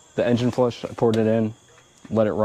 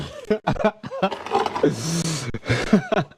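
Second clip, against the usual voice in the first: high giggle; 7 semitones higher than usual. Real, not acted. Laughs after mishap.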